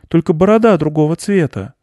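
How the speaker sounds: put-on high little boy's voice; surprised boy. A narrator's reading. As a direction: keep it high and childlike, wondering. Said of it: "удивился мальчик".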